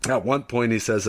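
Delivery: usual speaking voice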